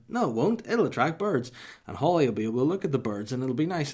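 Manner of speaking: mock her sing-song insistence; mocking wife. Mimicking his wife's cheerful reply, high and loud. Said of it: "She went".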